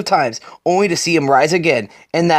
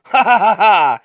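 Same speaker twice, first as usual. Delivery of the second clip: taunting laugh; 3 semitones higher, 4 dB louder than usual. Acted. Batman taunting the Shadow.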